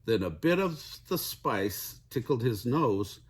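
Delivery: ordinary delivery, nothing marked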